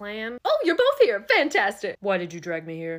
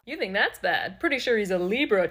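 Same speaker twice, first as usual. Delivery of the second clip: normal talking voice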